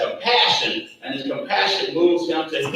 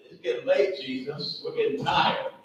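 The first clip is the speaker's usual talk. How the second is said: mock weary disciples; 10 dB quieter than usual. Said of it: "That's what they really are saying".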